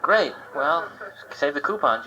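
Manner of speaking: ordinary talk, nothing marked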